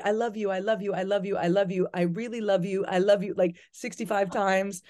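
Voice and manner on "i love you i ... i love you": mock gushing repetition. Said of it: "then he'll write".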